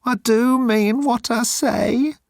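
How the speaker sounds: high, slow miserable plea; miserably. Acted. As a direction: say it as if about to cry. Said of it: "said Moan miserably".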